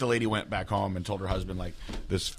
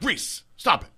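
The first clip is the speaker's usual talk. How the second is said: sharp firm call to the dog, higher and louder; firm dog command; 11 semitones higher, 6 dB louder, slower than usual. Retold or imitated. Owner commanding her dog to stop, sharp and firm. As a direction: snap a command at a straying dog. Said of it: "when I talk to her in that voice".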